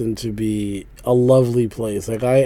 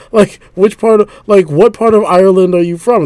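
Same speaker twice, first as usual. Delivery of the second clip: incredulous retort; 6 semitones higher, 7 dB louder than usual. Retold or imitated. Acted retort to the Irish lady.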